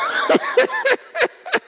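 long, very high giggling fit; helpless laughter. Real, not acted. Preacher cracking up at his phone story, helplessly laughing.

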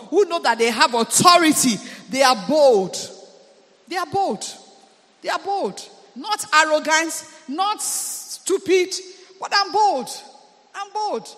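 preacher hitting his peak; full-force preaching. Acted. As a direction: preach it with mounting fire.

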